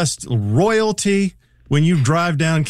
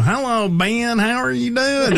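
put-on Knoxville Tennessee accent; 7 semitones higher than usual. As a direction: imitate a friend's Tennessee mother greeting you. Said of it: "It's like that accent is pervasive".

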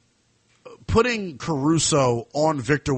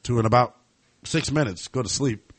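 usual speaking voice

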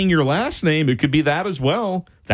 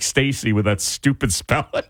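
normal talking voice

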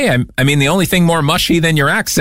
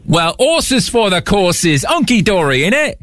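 exaggerated British accent, pitched up; higher, hammed-up British accent; 6 semitones higher, slower than usual. Shown as a demonstration. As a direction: reel off British slang in a broad put-on accent. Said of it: "we're on British terminology".

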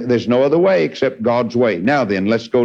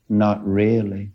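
dead sound; 4 semitones lower, 3 dB quieter, slower than usual. Retold or imitated. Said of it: "old dead sound".

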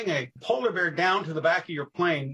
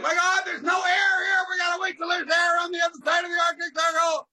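much higher and louder fake alarm; mock panic yell; 14 semitones higher, 4 dB louder than usual. Archie faking panic to scare teachers over the Arctic Circle. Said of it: "and Archie's yelling".

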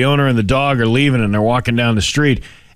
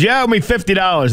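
higher, triumphant claim; smug dog owner; 8 semitones higher than usual. Retold or imitated. Owner demands fifty dollars from the bartender, gloating. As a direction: gloat as you collect.

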